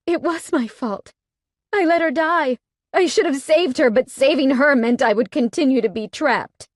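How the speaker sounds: high, loud self-blame; anguished. A narrator's reading. Heroine blaming herself to Cade, anguished. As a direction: cry out your guilt. Heroine insisting on her guilt.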